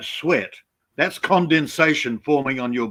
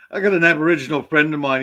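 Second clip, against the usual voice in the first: usual speaking voice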